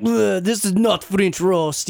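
mildly grumbling Frenchman, slow; disgusted French guy. Retold or imitated. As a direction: lightly mimic a fussy French coffee drinker. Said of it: "like the French guy keeps".

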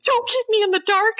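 high-pitched mock indignation; married-couple bickering. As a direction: squeal it like an aggrieved spouse. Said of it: "fighting like an old married couple".

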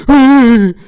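high, loud little put-on voice; voix du comptable. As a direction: squeal agreement as the obliging accountant. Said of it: "Est-ce que notre comptable est d'accord avec nous?". (petite voix aiguë et forte du comptable)

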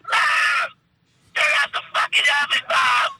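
high-pitched yell into another room; yelling to mom. Acted. Eddie yells off to his mom.

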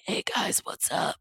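whispery lost voice. Retold or imitated. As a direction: croak it out with no voice left. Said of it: "loses their voice".

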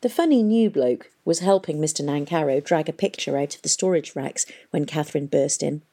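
ordinary delivery, nothing marked

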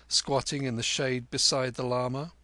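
plain reading voice

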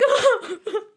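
short high giggle; high laugh. Real, not acted. Laughing about crying over the show, high giggle. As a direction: giggle at yourself.

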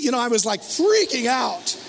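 normal talking voice

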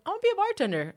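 high-pitched eager quote; naive self-imitation. Storyteller recalls deciding to bartend, naive high voice. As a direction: pitch it way up, mocking your younger self. Retells own naive ambition.

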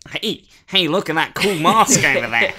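put-on high, loud excited kid; nerdy kid voice. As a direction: squeal it like an eager nerdy kid.

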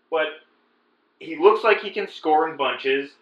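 normal talking voice

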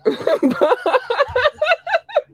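a burst of high-pitched laughter. Real, not acted. Laughing with his mom after her question, high laugh. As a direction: let a squealing laugh out.

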